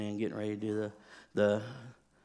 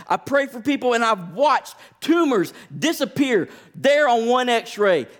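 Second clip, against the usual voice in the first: much higher and louder, rising fervour; impassioned preaching; 10 semitones higher, 10 dB louder than usual. Acted. Pastor pleading before the congregation, preaching at full force. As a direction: preach it to the rafters.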